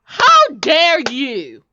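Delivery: a slow, loud, stretched-out how dare you; outraged. Acted. Mother outraged at her son's disrespect, slow and loud. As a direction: pull each word out, full of outrage.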